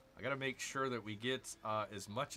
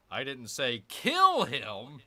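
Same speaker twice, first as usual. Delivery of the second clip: "kill" punched loud in "I didn't say kill him", slow; stress on kill; 7 dB louder, slower than usual. Shown as a demonstration. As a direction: lean on "kill". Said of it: "wherever you're emphasizing".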